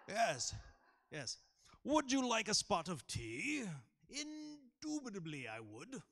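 high, loud, plummy aristocrat voice; hammed-up upper-class toff with monocle; fancy posh voice. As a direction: ham up a posh gentleman for the kids. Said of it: "can I be fancy this morning?".

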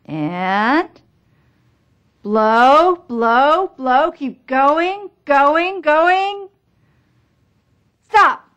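high, rising calls to keep blowing; urging coach cheer. Acted. Coach urging patient during blow test.

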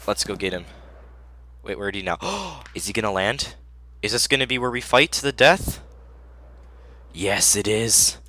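ordinary delivery

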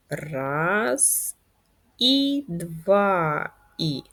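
long held beats pacing a pen stroke; slow stretched count. Shown as a demonstration. Teacher guiding pupils writing in the air, slow drawn-out count. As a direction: count very slowly, drawing out each vowel. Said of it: "Пишемо елементи в повітрі".

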